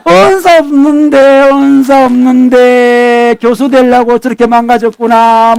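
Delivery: long drawn-out, sing-song mock lament. Preacher joking about colleagues without the gift, sing-song mock lament. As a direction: stretch every word into a teasing wail. Mocking thought repeated, drawn out.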